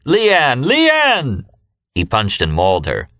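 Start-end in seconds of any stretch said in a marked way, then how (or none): 0.1-0.6 jubilant call. A narrator's reading. Remy calling out jubilantly. 0.6-1.4 jubilant call. A narrator's reading. Remy calling out jubilantly.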